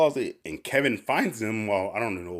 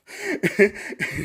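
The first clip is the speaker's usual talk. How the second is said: high laugh; 11 semitones higher than usual. Real, not acted. Laughing at own story.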